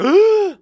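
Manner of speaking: sharp high gasp of disbelief; shocked gasp. Retold or imitated. Photographer shocked at being yelled at, high gasp. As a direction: gasp like you can't believe it. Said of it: "I was like".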